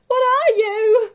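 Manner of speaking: high, loud shaking scream; terrified cry. Acted. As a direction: cry out shivering with fright. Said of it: "cries a lady, shivering on the spot".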